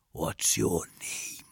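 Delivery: very slow, low, soft question; hushed, lingering menace; menacing whisper. A narrator's reading. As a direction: draw it out in a threatening whisper.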